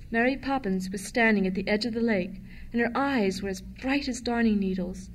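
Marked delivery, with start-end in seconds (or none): none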